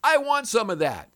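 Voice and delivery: high, eager little flea voice. Pretend. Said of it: "the flea says".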